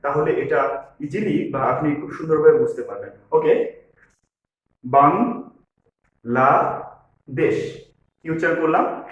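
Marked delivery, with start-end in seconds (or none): 4.8-7.8 বাংলাদেশ in three drawn-out syllables, pausing between each; teacher slowly splitting বাংলাদেশ into its three syllables. Shown as a demonstration. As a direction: say বাংলাদেশ very slowly as বাং, লা, দেশ. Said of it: "একটু ধীরে ধীরে উচ্চারণ করব".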